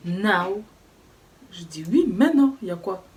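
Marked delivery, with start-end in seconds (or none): 0.1-0.6 loud, curt repeat of an order. Retold or imitated. Receptionist repeating herself, curt and impatient. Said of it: "elle répète en plus". 1.9-3.0 high, loud indignant retort. Retold or imitated. Student retorting to the rude receptionist, indignant and loud.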